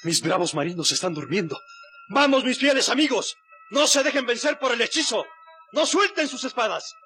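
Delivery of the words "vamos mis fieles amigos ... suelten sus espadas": rallying shout. Acted. Simbad rallies his sleeping sailors.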